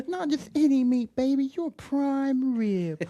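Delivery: high, slow, laughing put-on voice; movie character quote. Podcaster acting out the prime rib scene, high and laughing. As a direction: stretch it out in a silly high voice.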